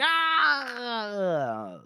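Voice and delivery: drawn-out mock war scream, falling away; imitated scream. Warriors screaming at soldiers, long mock scream. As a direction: scream it long and high, then let it die. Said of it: "they were screaming".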